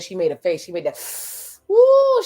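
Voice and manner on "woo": loud, high-pitched whoop; high whooped "woo". Real, not acted. Recapper reacts to her sipping her drink, whooping.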